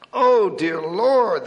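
slow, loud, drawn-out groan of pain; pained muttering. Acted. Injured pastor groaning to God, slow and pained. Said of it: "the parson muttered".

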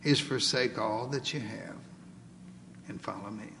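lightly voiced Lord, sinking low and soft; grave instruction, fading to very quiet; words of the Lord. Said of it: "looks directly into our eyes and says".

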